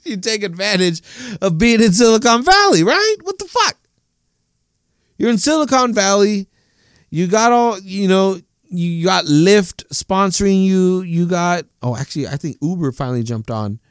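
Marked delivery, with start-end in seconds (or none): 0.0-11.6 excited rant. Real, not acted. Worked-up rant about Silicon Valley.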